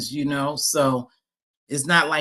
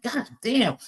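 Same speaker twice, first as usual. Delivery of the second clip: exasperated; 7 semitones higher, 3 dB louder than usual. Retold or imitated. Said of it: "and I'm like".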